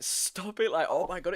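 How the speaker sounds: high, loud mock protest; exaggerated distressed begging; imitated upset pleading. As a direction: plead like someone trying not to cry, hammed-up. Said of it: "he kept tearing up".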